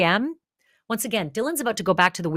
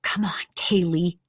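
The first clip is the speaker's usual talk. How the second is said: whisper. Retold or imitated. Caller whispering to herself on the 911 call, hushed. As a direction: barely breathe the words out. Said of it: "you hear Dylan whisper".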